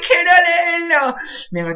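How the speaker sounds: ordinary talk, nothing marked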